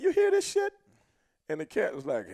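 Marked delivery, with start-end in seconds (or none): 0.0-0.7 loud, high retold disbelief; incredulous appeal. Wrestler turning to his partner about the rookie, loud disbelief.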